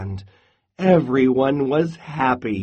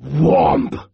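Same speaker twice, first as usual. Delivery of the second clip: loud imitated thud; sudden loud whomp; 6 dB louder than usual. A narrator's reading. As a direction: slam the word down like a heavy paw. Imitated thud of Bear's paw.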